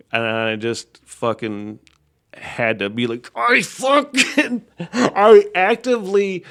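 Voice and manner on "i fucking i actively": much higher, strained and slow, laughing through it; comically restrained. Real, not acted. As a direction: hold the rage in with a squeaky laugh. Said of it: "restrained myself in probably the most comical way".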